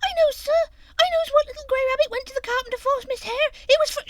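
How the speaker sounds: very high, loud and fast little voice; high excited chatter; eager child blurting. A narrator's reading. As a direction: rush it out like a bursting child. Fuzzy Peg blurting secret.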